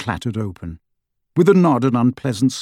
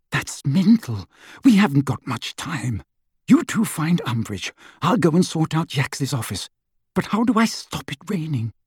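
put-on higher anxious teen boy; worried young Ron, pitched up; 5 semitones higher than usual.

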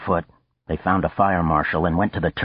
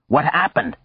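raised, urgent shout over the riot; shouted question; 8 semitones higher than usual. Acted. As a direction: yell it to be heard above the noise. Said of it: "he shouted".

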